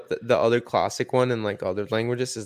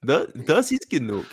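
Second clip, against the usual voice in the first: higher, slow mock-accented German; put-on accent in German; 4 semitones higher, slower than usual. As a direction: draw out the German in a playful accent. Playing mixed accents in German.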